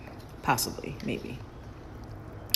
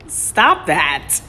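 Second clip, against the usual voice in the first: louder exasperated snap; loud annoyed scold; 3 semitones higher, 10 dB louder than usual. Real, not acted. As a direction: tell them off out loud. Scolding the teasing partner again.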